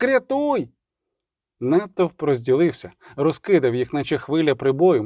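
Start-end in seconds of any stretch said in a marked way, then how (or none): 0.0-0.7 very high cry for help; shrieking. A narrator's reading. Dandelion begs to be rescued, shrieking. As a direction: wail the plea, pitched right up. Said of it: "верещав Любисток".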